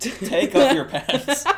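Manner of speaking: high, slow, laughing it out; giggling through the line. Real, not acted. Joking advice to the kids.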